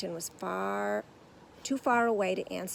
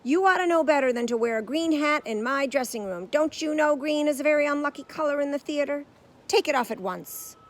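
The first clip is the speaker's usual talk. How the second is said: cross; 7 semitones higher, 9 dB louder than usual. A narrator's reading. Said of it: "he said crossly".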